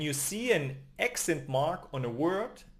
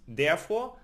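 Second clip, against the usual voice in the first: ordinary talk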